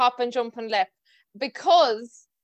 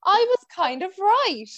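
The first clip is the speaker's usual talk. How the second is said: triumphant; 8 semitones higher, 5 dB louder, slower than usual. Retold or imitated. Finally vindicated after ten years.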